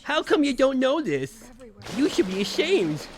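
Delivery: high mocking troll taunt. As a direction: scold like a sneering troll. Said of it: "your troll voice is so on point".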